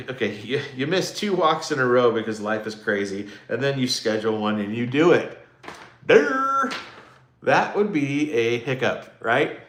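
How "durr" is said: high, loud, drawn-out "durr"; mock dopey "durr". Host mocking the too-easy quiz question, silly loud durr. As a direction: blurt a silly high noise.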